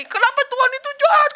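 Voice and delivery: put-on high, loud wailing little girl; crying child. Girl crying to her mother after losing, high and loud. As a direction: wail it like a heartbroken child. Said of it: "Kata anaknya".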